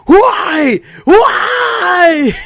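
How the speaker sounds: very high, loud wailing shout; mock anguished scream. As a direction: howl the comment like the dad. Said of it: "somebody wrote".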